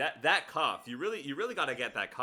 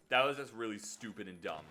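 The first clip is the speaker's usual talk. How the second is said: ordinary delivery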